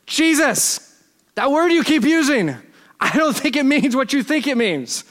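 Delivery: high, incredulous challenge; exasperated objection. Acted. Imagined listener correcting Jesus with Inigo's line, exasperated.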